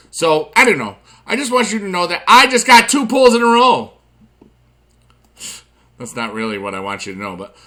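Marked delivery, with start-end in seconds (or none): 1.3-3.9 excited. Real, not acted. Excited about two pulls.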